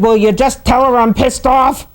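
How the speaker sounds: hammed-up horse losing patience; insistent mock anger. Horse insists a third time.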